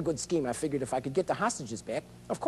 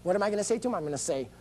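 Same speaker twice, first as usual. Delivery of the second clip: ordinary delivery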